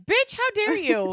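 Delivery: high, loud, drawn-out indignation; mock outrage. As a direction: play it scandalized, slow and high. Imagines her own outraged reaction.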